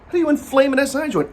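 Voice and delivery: high, fast disbelieving question; incredulous. Real, not acted. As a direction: ask it in disbelief. Incredulous repeat, much higher.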